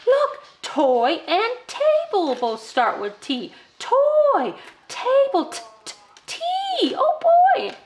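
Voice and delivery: put-on child-directed excitement. Shown as a demonstration. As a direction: make the t sound a thrill. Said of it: "And then you could say".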